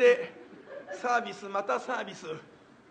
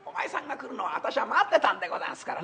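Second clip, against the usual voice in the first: put-on old master's voice. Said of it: "黒門町が言うかと思うと".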